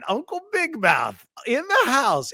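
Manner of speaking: high, slow mocking singsong; teasing Uncle Big Mouth. Friend teases the leaker, high mocking singsong. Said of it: "he still calls me Uncle Big Mouth".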